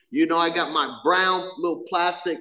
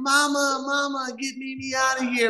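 higher mock cry for mama; imitating screaming sister; 7 semitones higher than usual. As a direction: plead for mom like a scared little girl. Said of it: "she's screaming".

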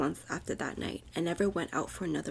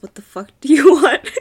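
angry commanding; 6 semitones higher, 15 dB louder than usual. A narrator's reading. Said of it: "in an angry, commanding tone".

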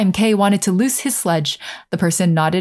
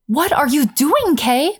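high, drawn-out cry of alarm; higher, slower alarmed question; little girl's cry; 8 semitones higher, slower than usual. A narrator's reading. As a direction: cry out in alarm, without shouting. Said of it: "cried the little girl".

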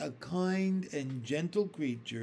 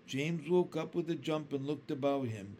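usual speaking voice

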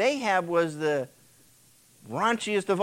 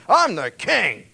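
proud declaration; 3 semitones higher, 8 dB louder, slower than usual. Retold or imitated. Ahaziah asserts power.

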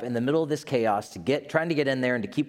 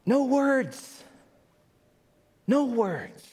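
high, slow, breathless awe; awestruck whisper; 12 semitones higher, much slower than usual. Retold or imitated. Scientist floating before a forming galaxy, high slow awestruck. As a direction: marvel at the galaxy being born. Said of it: "she says".

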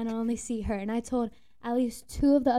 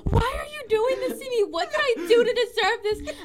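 mock crying coworker; 11 semitones higher, 4 dB louder than usual. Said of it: "she comes to me crying, and she's like".

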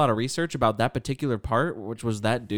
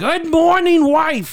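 much higher, much louder squawking comic voice; Gilbert Gottfried impression; 9 dB louder, much slower than usual. Mocking how the comic talks at home, loud high squawk. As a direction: screech it out like the famous comic. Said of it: "Or it's like Gilbert Gottfried".

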